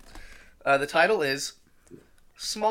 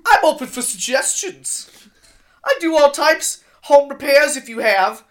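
higher, louder cartoon octopus chef; 6 semitones higher, 5 dB louder than usual. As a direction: read the personal ad as a fussy cartoon octopus chef. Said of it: "doing the octopus chef voice".

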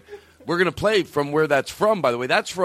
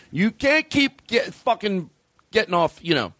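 normal talking voice

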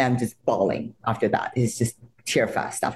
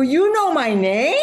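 high, slow, louder disbelief; amazed disbelief; 4 dB louder, slower than usual. Retold or imitated. Fan amazed a star knows her name, high disbelief. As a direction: ask like you can't believe it.

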